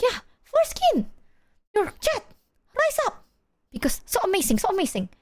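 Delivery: high-pitched gleeful mom impression; squeaky mock enthusiasm; oblivious mom. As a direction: imitate a mother chirping happily, way up high. Imitating laughing, oblivious mom.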